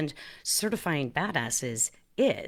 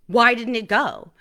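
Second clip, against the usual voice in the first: retold panic; 4 semitones higher, 6 dB louder, slower than usual. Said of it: "I'm panicking".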